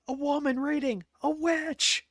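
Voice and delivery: high, loud cry of accusation; mock distant shout. Imitating someone shouting at a Renaissance fair, mock alarm. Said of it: "you hear someone in the distance shout".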